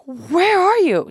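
loud, high, drawn-out wail; near meltdown. Retold or imitated. Stranded daughter phones her mom, near meltdown. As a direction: wail it slowly, at breaking point. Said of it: "on the verge of".

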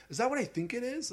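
high-pitched imitation of an Australian waiter; Australian accent. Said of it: "he had, like, an Australian accent".

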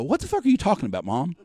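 high, loud, disbelieving demand; retold exasperation, pitched well up; incredulous outburst. Son cutting in on his mom, incredulous outburst.

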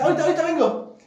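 hurried-off excuse in a high voice; high-pitched excuse. Retold or imitated. Father excusing himself from Eusebio's house, high-pitched excuse.

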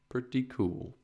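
hushed satisfied echo; soft echo. Real, not acted. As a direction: quietly agree with yourself. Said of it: "Pretty cool, right?".

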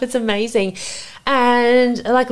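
ordinary talk

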